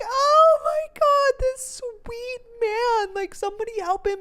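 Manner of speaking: high-pitched, drawn-out tearful wail; hammed-up sobbing over someone pitiful; mock crying. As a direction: squeal it through pretend tears. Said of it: "I just, like, start crying".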